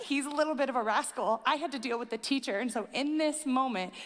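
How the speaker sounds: usual speaking voice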